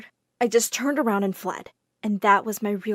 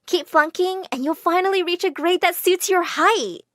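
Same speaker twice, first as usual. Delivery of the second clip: high-pitched mocking jab; smirking taunt; 7 semitones higher than usual. A narrator's reading. Ex-best friend mocking the narrator's grades and height, smirking. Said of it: "My ex-best friend smirked and said".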